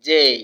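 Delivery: loud "জেই" with an ei glide; correct letter J. Shown as a demonstration. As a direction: model "জেই", gliding from e to i. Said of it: "জেকে আমরা বলবো".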